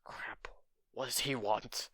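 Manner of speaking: whispered, panicked aside to himself; inner thought whisper. Retold or imitated. Izuku thinking in a nervous whisper. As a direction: hush it like a private thought. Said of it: "and in his mind, like".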